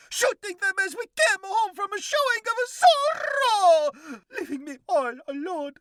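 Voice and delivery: high, loud mock-sobbing lament; hammed-up wailing. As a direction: blubber it like an overgrown orphan. Cockroach wails about orphaned childhood.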